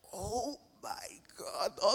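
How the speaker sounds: breathless, hushed oh my god; scared gasp. Retold or imitated. Surfer realizing sharks may be near, hushed dread.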